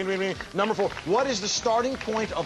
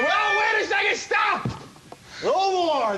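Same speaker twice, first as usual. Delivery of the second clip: shouting stop; 11 semitones higher, slower than usual. Real, not acted. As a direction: yell to halt the game. Host halting the lightning round.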